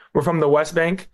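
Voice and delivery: ordinary talk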